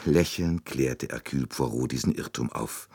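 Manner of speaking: plain reading voice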